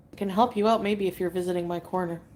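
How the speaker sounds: usual speaking voice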